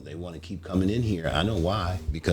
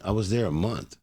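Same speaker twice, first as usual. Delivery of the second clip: ordinary delivery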